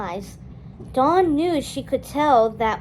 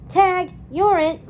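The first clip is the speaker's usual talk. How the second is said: bright teasing call, pitched up; playful tag call; 6 semitones higher, 6 dB louder than usual. A narrator's reading. Friend starting a game of tag, playful call. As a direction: tease her and run off. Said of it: "Felipa said".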